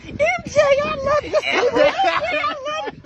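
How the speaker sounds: put-on very high, giddy fan-girl voice, slowing to a laugh; squealing fan girls. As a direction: play the club girls gushing over him. Girls run up to him.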